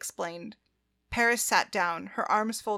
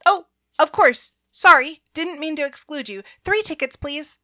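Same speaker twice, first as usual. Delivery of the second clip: high, loud flustered correction; flustered apology; 6 semitones higher, 11 dB louder than usual. A narrator's reading. Apologizing for excluding her companion.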